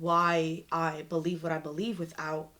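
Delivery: ordinary talk, nothing marked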